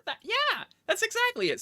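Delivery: thrilled the player guessed right; excited. Real, not acted. GM to player who guessed the counteroffer, excited.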